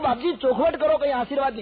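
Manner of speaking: high, loud begging merchant; pleading merchant. Acted. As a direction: chase after him begging. Merchant pleading after saint.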